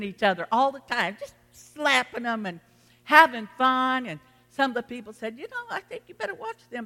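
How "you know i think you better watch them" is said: mock hushed, high-pitched warning; concerned warning. Church member warns the pastor about two people, concerned warning. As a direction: imitate a worried tattler, quick and softer.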